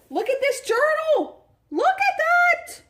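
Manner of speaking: high, loud squealing delight; excited squeal. Real, not acted. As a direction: squeal over the journal. Excited unboxing.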